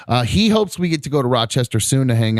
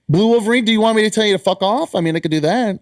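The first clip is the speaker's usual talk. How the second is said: mock angry; 11 semitones higher, faster than usual. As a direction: play at being fed up. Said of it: "I'll probably come in angry about something".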